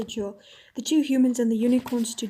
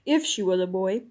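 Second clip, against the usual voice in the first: lower, dry kingly echo; king's stern echo; 4 semitones lower than usual. Acted. King answering Eustace, lower and dry. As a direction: turn the phrase back, low and stern.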